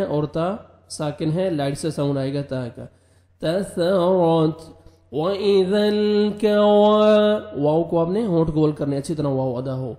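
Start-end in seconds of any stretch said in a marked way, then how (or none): 3.4-7.4 drawn-out melodic tajweed chant, higher and louder; Quran recitation. Shown as a demonstration. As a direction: chant the verse slowly like a qari.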